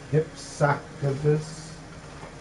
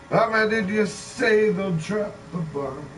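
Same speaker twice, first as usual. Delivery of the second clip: usual speaking voice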